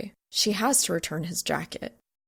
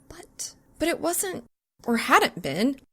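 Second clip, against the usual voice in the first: flustered whisper; 7 semitones higher than usual. A narrator's reading. Flustered inner thought.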